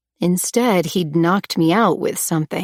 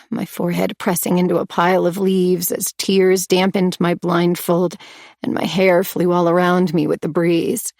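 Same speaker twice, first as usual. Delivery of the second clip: ordinary delivery, nothing marked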